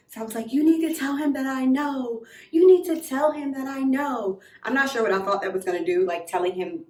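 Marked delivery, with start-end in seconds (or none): 0.5-4.4 higher, insistent demand; retold threat. Betrayed girl orders friend to tell him, threatening. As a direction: press it like an ultimatum. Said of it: "that was like a threat".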